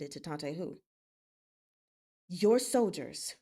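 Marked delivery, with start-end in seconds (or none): 2.3-3.4 "Your soldiers" slow and weighted; emphasized words. Acted. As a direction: lean hard on "Your soldiers". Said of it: "Xiao Chuye emphasized the words".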